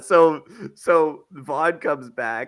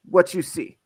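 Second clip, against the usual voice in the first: higher mock Cuban woman's voice; short Cuban-accented question, pitched up; 5 semitones higher than usual. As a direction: ask it in a put-on Cuban accent. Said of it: "She was Cuban".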